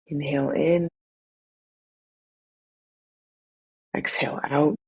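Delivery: measured, soothing body-scan guidance; guided meditation. Acted. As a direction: ease them into noticing their breath, unhurried.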